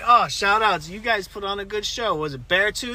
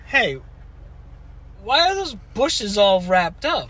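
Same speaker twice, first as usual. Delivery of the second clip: loud curious question; 4 semitones higher, 5 dB louder, slower than usual. Retold or imitated. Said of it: "she goes".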